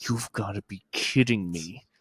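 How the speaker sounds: slow, low, soft disbelief; mimicked exasperation. Customer stuck at self-checkout, slow exasperated grumble. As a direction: grumble it slowly, imitating a fed-up customer.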